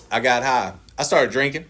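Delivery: normal talking voice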